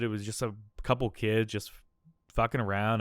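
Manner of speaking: ordinary talk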